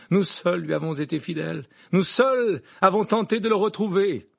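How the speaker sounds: loud, high, fervent declaration; defiant proclamation. Acted. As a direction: hurl it proudly at the judge. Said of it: "et lança".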